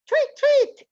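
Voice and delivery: very high tweet; bird tweets. Shown as a demonstration. Child in the guessing game tweeting high. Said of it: "try to guess what kind of bird".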